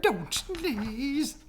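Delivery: high, fretful bird voice; pleading birds. Acted. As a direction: complain in a high chirpy voice.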